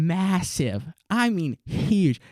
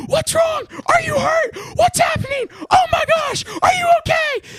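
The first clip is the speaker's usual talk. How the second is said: high, loud frantic mom voice; panicked mother yelling questions; 4 dB louder than usual. Retold or imitated. Mom running out of her room, high frantic yelling. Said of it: "My mom comes running out".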